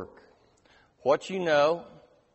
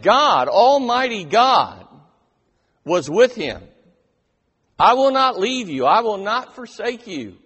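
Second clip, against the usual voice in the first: fervent preaching; 5 semitones higher, 8 dB louder than usual. Acted. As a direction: build to a thundering proclamation. Preacher building to a climax.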